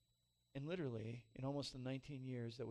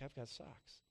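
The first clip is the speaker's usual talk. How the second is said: tearful, softer; 6 dB quieter, slower than usual. Retold or imitated. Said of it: "I've been crying".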